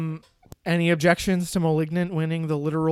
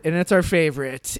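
ordinary talk, nothing marked

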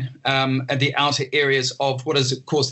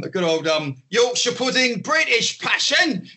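much higher Cockney showman, slowing on British passion; Cockney accent, higher and slowing; mock Cockney; 10 semitones higher, slower than usual. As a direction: stretch out British passion like a hammy Cockney waiter. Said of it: "some kind of Cockney or, or kind of accent like that".

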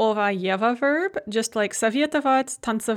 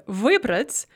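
wybrać stressed on the y of the prefix; stress on first syllable. Shown as a demonstration. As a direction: put the weight on the opening vy of wybrać. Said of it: "has stress on the y".

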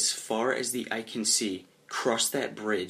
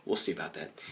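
low, softened doubtful mutter with a chuckle; under the breath; 4 semitones lower, 5 dB quieter than usual. Acted. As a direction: mutter it aside, trailing lower. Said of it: "Under my breath".

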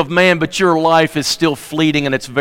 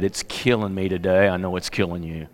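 hushed, grieving; 12 semitones lower, 10 dB quieter than usual. Real, not acted. Quoting a grieving man.

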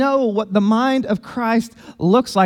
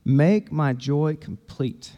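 scripture reading; 6 semitones lower, 3 dB quieter than usual. Acted. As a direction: read the verses quietly, letting each phrase land. Reads the scripture passage aloud.

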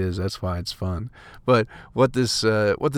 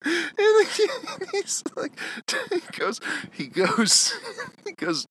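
high, breathless laughing; can't finish for laughing; slower than usual. Real, not acted. Laughing, repeating he goes.

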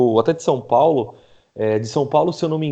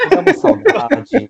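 mock nostalgic; 12 semitones higher, 4 dB louder, slower than usual.

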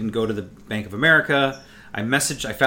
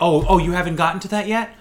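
fake innocent; 5 semitones higher, 4 dB louder than usual. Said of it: "pretending to go, like, over and touch the thing".

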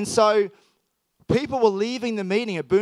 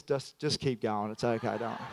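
gentle policeman; 7 semitones lower, 9 dB quieter than usual. Retold or imitated. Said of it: "He goes".

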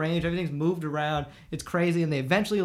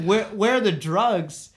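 baffled echo; 2 semitones higher, 4 dB louder, slower than usual. Retold or imitated. Teen repeating the cop's demand, baffled. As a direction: stretch it out in total confusion.

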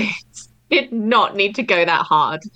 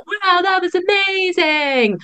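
awed viewer; 10 semitones higher, slower than usual. Retold or imitated. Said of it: "then they'd be like".

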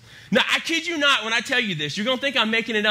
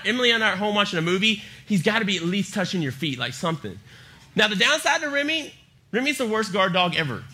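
usual speaking voice

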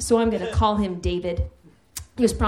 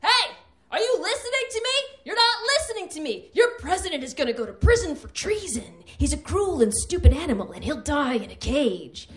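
much higher, frantic mock rant at two strangers; angry rant; 8 semitones higher than usual. Comic imagining herself yelling at bus men, shrill frantic rant. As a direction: scream at them to listen. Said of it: "here's me".

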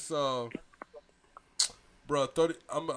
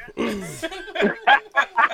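big laugh bursting out, higher and louder; emotional laugh; 9 semitones higher, 9 dB louder than usual. Real, not acted. Brother overwhelmed by his older brother's call, laughing loudly. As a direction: let a moved laugh burst out.

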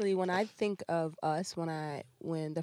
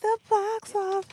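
high announcer voice; 4 dB louder, slower than usual. Acted. Echoing intro line in a put-on voice.

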